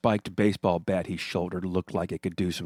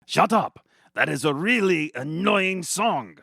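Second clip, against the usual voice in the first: louder and higher, fed-up yell; angry shout; 8 semitones higher, 4 dB louder than usual. Acted. Gargamel shouting angrily at the singing Smurfs. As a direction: yell at them to stop that song. Villain yells at singers.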